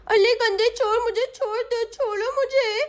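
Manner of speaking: put-on high, childish lisping voice; protesting little girl. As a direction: plead and scold like a small girl held captive. Caught girl protests to thief.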